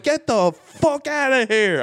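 high, slow, drawn-out furious order; furious retold yell. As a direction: lose your mind at him. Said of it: "I was losing my mind".

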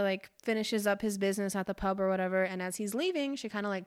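normal talking voice

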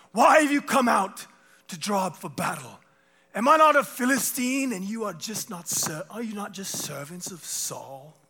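high, booming challenge in mock British; shouted taunt. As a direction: bellow it dramatically to the enemy ranks, then ease off. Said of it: "he shouted to the ranks of Israel".

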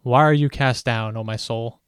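usual speaking voice

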